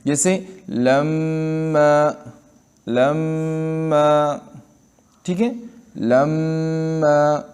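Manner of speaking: لما with the doubled م held one alif long; nasal hold demo. Shown as a demonstration. Teacher demonstrating ghunna on لما very slowly. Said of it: "ایک الف کے مقدار رکنا ہے".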